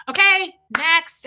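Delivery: high, loud, drawn-out call; rigid timekeeper. Acted. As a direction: call time like a strict event organizer. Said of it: "somebody like Shelly who's rigid".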